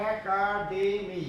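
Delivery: একাডেমি said very slowly, syllable by syllable. Shown as a demonstration. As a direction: spell out একাডেমি slowly for students. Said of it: "উচ্চারণটা হচ্ছে". (ধীরে ধীরে একাডেমি উচ্চারণ)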